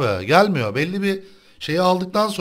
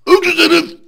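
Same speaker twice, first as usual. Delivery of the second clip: much higher, louder insult; angry outburst; 10 semitones higher, 6 dB louder than usual. Real, not acted. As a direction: spit the insult at the camper. Echoing the insult at enemy.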